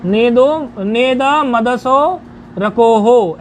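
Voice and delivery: नेद मदसो रकोहो slow and joined, final o's held; long drawn vowels in नेद मदसो रकोहो; metrical pronunciation. Shown as a demonstration. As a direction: model नेद मदसो रकोहो as a line of verse. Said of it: "ऐसे इसका उच्चारण है".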